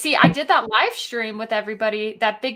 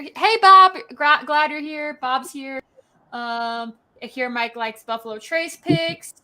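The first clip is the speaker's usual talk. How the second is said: ordinary talk, nothing marked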